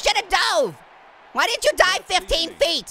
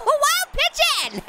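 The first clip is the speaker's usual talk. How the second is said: drawn-out high squeal; sing-song taunt at the pitcher; high stretched call; 11 semitones higher, much slower than usual. Pretend.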